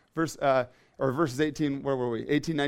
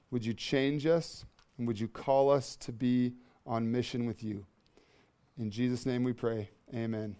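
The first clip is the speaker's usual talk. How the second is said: prayer; 4 semitones lower than usual. Acted. Pastor praying before the sermon, reverent and hushed. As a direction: pray humbly to the Father.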